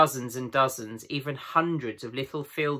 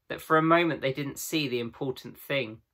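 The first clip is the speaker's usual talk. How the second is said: usual speaking voice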